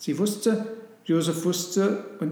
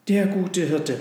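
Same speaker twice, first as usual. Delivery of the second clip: louder, higher call of "Hirte"; 4 semitones higher, 6 dB louder than usual. Acted. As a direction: echo it aloud in awe. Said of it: "riefen die Kamele".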